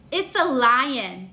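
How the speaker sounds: usual speaking voice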